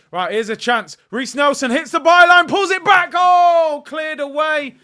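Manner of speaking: high, loud rising commentary shout; excited shout. Real, not acted. Fan streamer yelling as Arsenal nearly score, loud and high. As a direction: yell it as the chance builds, then deflate.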